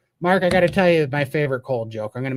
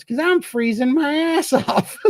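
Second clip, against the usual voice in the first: put-on high, slower kid voice breaking into a laugh; cub's punchline; 13 semitones higher, slower than usual. Baby bear finally admitting he's freezing, high and laughing. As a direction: land the punchline as the cub, laughing. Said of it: "He goes".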